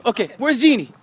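ordinary talk, nothing marked

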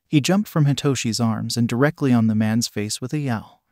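ordinary delivery

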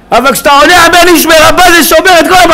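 high, shouted exhortation; full-force preaching. Real, not acted. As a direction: thunder at the congregation, urging belief. (דרשה בצעקות)

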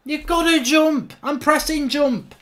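high-pitched, frustrated complaint; exasperated protest. Real, not acted. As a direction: plead crossly with the game. Protesting at the game not responding.

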